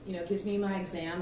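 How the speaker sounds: usual speaking voice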